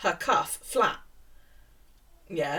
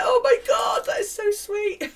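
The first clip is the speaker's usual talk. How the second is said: high, loud gush, slowing at the end; hammed-up, overcome with emotion; mock overwhelmed gushing; 13 semitones higher, 8 dB louder than usual. Teacher answering student on phone, gushing and overwhelmed. Retold tearful reply to caller.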